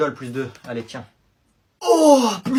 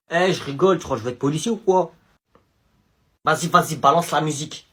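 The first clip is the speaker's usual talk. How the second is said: ordinary talk, nothing marked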